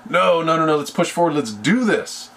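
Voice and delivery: lightly hammed-up pep talk; urging them on. Acting out the motivator rallying others.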